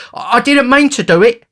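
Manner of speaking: very high, loud whining excuse; high-pitched snivelling youth; blubbering. A narrator's reading. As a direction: wail it like a frightened overgrown child. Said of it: "said Noah, blubbering".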